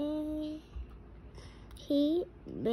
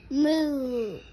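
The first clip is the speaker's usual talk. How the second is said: a lightly voiced moo, read aloud; cow moo; 4 dB louder than usual. Shown as a demonstration. Parent reading to a toddler, imitating a cow moo. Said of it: "He can sound like a cow".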